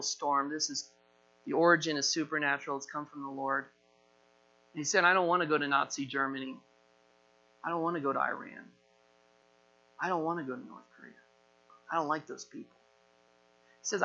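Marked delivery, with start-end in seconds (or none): 5.0-12.8 lightly acted, soft quick refusal; reluctant grumble. Jonah refusing to go to his enemies, reluctant grumble. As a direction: grumble it quietly, a touch sulky.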